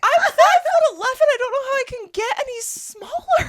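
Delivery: high, loud, laughing plea; exasperated protest. Retold or imitated. Tall passenger protesting about the cart, exasperated high shout.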